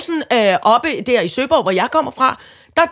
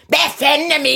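retold angry shout; 5 semitones higher than usual. Shopper storming out of the shop, imitated angry shout. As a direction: re-enact yelling on the way out. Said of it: "mens jeg råbte".

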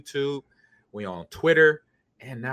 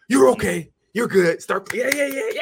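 loud, high reassurance building higher; parent's distraction protocol; 9 semitones higher, 7 dB louder than usual. Shown as a demonstration. Host demonstrates distracting child from pain, loud cheery hype. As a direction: act out hyping a hurt kid out of tears. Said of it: "You have that, that protocol".

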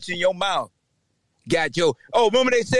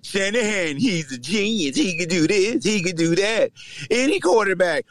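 ordinary talk

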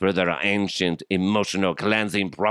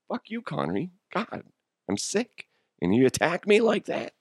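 mock offended; 10 semitones higher than usual. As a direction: act hurt and hoarse. Mock hurt reply to Connery.

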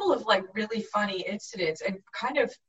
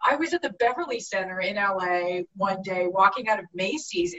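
ordinary delivery

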